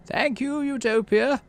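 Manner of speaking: put-on high, warm old governor's thanks. Governor Udicott thanking Utopia warmly. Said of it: "Udicott says warmly".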